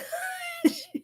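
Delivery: a burst of high laughter; short real laugh at the joke; sudden high laugh. Real, not acted. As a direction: break into a quick laugh.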